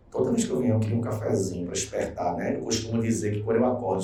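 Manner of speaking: low, hushed, mostly quick chatter; quiet confiding aside. Real, not acted. Teacher confessing a coffee craving to students, quiet aside. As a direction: drop right down into a soft private aside.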